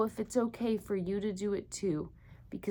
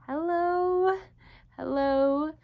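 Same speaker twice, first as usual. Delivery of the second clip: bright greeting; 7 semitones higher, 4 dB louder, slower than usual. Acted.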